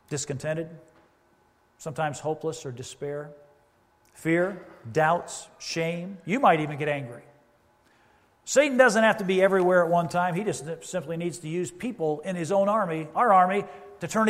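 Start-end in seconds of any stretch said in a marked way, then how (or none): none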